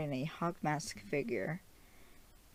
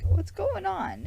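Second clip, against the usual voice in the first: much higher, louder and slow; drawn-out alarmed question; alarmed Layla; 7 dB louder, slower than usual. Retold or imitated. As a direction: girlfriend alarmed at his confusion, high and slow. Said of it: "And Layla's like".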